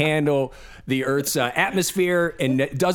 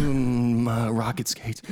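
imitated co-host; 6 semitones lower, slower than usual. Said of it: "You're gonna be like".

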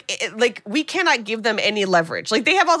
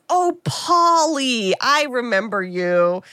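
imitated fond recognition; much slower than usual. Mother with Alzheimer's finally remembers her son, imitated fond recognition.

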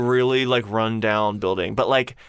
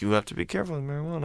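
quick, soft, higher mumble imitating the doctor; mumbled warning; 4 semitones higher, 7 dB quieter, faster than usual. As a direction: mumble the caution fast, under your breath. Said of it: "Mumbling it".

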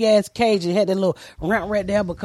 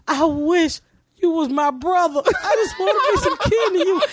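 put-on high, pleading kid; longing child; 6 semitones higher than usual. Comic voicing her young self at the cage, high yearning. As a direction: beg to be his sibling, lightly voiced child.

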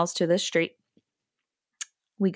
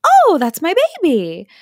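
gushing owner; 9 semitones higher, 7 dB louder than usual. Pretend. How an owner should react.

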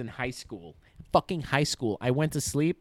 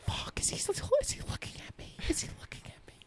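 furtive, whispered inner panic; acted nervous muttering, all breath and no voice; mock paranoid whisper; 8 dB quieter than usual. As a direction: whisper it as if afraid of being overheard. Acts out paranoid inner thoughts.

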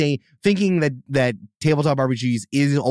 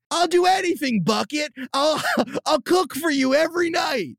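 high, hammed-up pleading with a laugh; desperate begging; 12 semitones higher than usual. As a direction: grovel for another chance. Improvised breakup scene.

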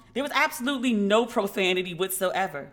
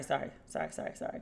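fading to a hushed whisper; whispered apologies; 7 semitones lower, 13 dB quieter, faster than usual. Real, not acted. She apologizes for the wrong page, fading into a whisper. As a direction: trail off apologizing quietly.

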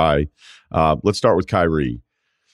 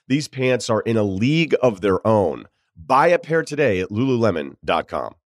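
ad read; 4 semitones higher than usual. Acted. Host reads sponsor ad.

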